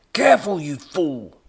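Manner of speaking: high, very loud warning; angry yell. A narrator's reading. Professor to robot knocking equipment, angry yell. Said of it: "the professor yelled".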